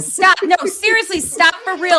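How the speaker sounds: high, loud nagging mom. Real, not acted. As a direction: scold like a fed-up mother. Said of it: "I'm putting on my mom voice".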